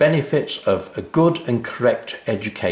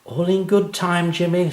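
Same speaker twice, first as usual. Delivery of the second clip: smiling teacher; 10 semitones higher than usual. Acted. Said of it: "smiled Missus Jensen".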